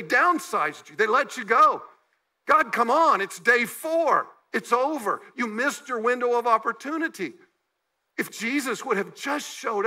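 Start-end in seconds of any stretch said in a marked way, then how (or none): 2.5-7.3 louder, pushing at God in frustration; exasperated complaint. Pretend. Laid-off worker complaining to God, exasperated and loud. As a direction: come on, it's over, you missed it.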